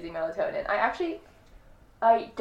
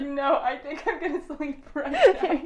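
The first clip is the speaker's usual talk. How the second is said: laughing insistence; 6 semitones higher, 4 dB louder than usual. Retold or imitated. Retold insisting to Brooke, laughing.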